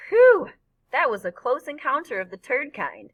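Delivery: high, loud whew, then the joke; relieved wisecrack. A narrator's reading. Freak joking to his friend after the narrow escape, relieved wisecrack. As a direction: blurt it out with big relief.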